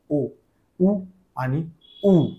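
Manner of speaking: "उ", then "ऊ", each set apart with rounded lips; rounded-lip vowels. Shown as a demonstration. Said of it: "ओठ जे आहेत त्यांना round करावं लागतं". ("उ" आणि "ऊ" ओठ गोल करून)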